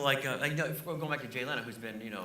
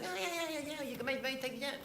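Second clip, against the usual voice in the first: Jay Leno voice; 13 semitones higher, faster than usual. Retold or imitated. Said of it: "He said".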